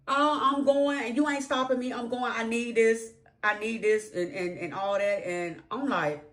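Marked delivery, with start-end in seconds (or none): 0.1-4.1 imitating insistent wife. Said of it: "wife insists on going".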